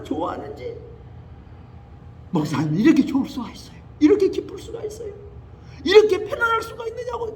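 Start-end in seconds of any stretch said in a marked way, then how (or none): 2.3-7.3 higher, delighted questions; overflowing with relief; healed woman marvelling to the pastor; joyful exclaiming. Retold or imitated.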